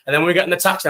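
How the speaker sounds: ordinary delivery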